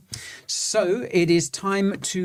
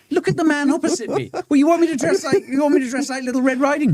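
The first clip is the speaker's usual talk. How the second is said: outraged squeak; 12 semitones higher, faster than usual. Real, not acted.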